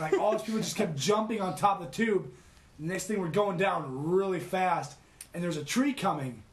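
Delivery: ordinary delivery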